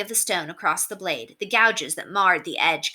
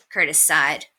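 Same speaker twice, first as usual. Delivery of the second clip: ordinary delivery, nothing marked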